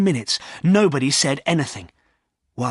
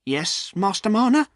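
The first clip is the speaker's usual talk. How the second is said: trembling a little; 9 semitones higher than usual. Acted. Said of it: "trembling a little".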